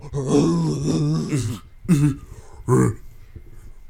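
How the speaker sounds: loud, high mock lion moans; cartoonish lion mating noises; imitated lion noises. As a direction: growl and moan like an amorous lion. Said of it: "you just see him go".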